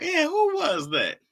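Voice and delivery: playing dumb, stretching it out; mock surprised question. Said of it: "I was like".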